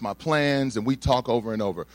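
usual speaking voice

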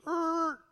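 high, drawn-out mouth-made scratch; high-pitched needle-scratch noise; imitated record screech. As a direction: mimic a record screeching to a stop. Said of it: "the records just screech".

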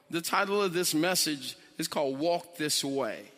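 ordinary delivery

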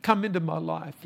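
high, earnest words of a simple prayer; sample prayer. Shown as a demonstration. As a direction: model the prayer for the congregation to repeat. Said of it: "And just simply say".

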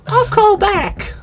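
high, slow granny voice; squeaky old lady voice; high old nana voice. Retold or imitated. Nana voice on phone ad.

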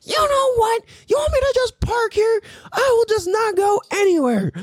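very high hammed-up huffy voice; mock indignant lady. The line cutter threatening to park there, high mock outrage. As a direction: play her outraged threat. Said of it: "And then she was like".

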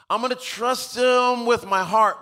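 high, drawn-out pledge; stretched, high-pitched vow; mock devotion. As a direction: act a half-committed believer promising grandly. Voicing half-committed believer.

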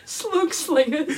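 slow, high, laughing through the title; laughing mockery. Real, not acted. Mocking the show title, laughing.

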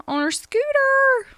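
on her scooter said high and loud, pitch leaping up; dramatic emphasis. Shown as a demonstration. Mom retelling her daughter's fall to viewers, high and loud. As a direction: throw the repeat up high in disbelief.

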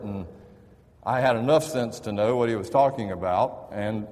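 ordinary talk, nothing marked

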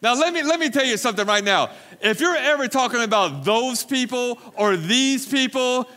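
preacher calling out his church; fired-up rebuke. Real, not acted. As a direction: scold the room, fast and loud.

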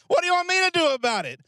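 high, fast exasperated brush-off; uncaring, unloving. Shown as a demonstration. Answering someone who brings you a problem, uncaring and exasperated. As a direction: brush them off in an uncaring whine. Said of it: "That sounds very uncaring, very unloving".

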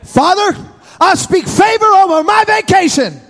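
put-on a boy praying boldly; child praying. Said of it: "before God".

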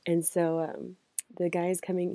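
ordinary talk, nothing marked

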